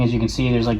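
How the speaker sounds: ordinary delivery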